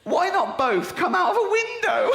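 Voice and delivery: high, loud disbelieving read; loud mock disbelief; incredulous shout. Real, not acted. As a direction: build to the punchline in disbelief. Climactic flyer line read.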